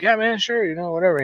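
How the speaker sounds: acted-out offhand yes; easygoing agreement. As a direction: toss off a breezy yes. Acting out agreeing to a shot.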